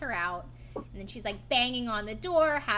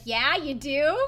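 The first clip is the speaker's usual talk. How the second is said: sarcastic; 6 semitones higher, 12 dB louder than usual. Retold or imitated. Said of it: "everyone's like".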